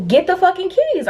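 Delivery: high-pitched, fed-up demand; retold frustrated command; exasperated order. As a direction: order a friend, pitched right up. Confronting her friend over the keys.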